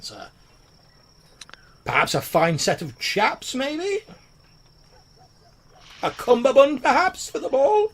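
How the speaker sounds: high, pushy, sing-song sales pitch; eager tailor salesman. Acted. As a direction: sell him something fancy, voice climbing. GM voices tailor selling clothes.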